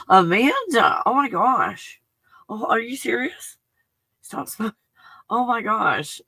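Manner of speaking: high, gasping oh my gosh at the news; gasp, then gush over the good news; surprised delight. Real, not acted. Host reacts to Amanda quitting smoking, gasping in surprise.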